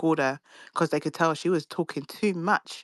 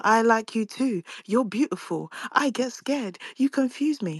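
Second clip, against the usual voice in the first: higher, rising mock girlish confession; mocking lovestruck voice; 6 semitones higher, slower than usual. Mocking how she confessed her feelings, high and girlish. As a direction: parody her gushing sweetly. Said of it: "went and told her".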